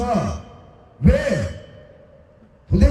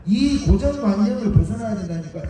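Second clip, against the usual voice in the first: usual speaking voice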